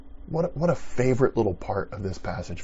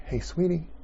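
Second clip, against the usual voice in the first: affectionate call; 7 semitones higher than usual. Retold or imitated. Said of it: "He's saying".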